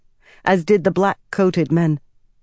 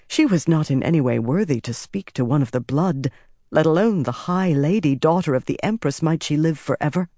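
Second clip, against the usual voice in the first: ordinary delivery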